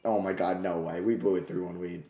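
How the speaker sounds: quiet, low, deflated; stunned disbelief. Real, not acted. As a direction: say it like the air left you. Lead just blown, quiet shock.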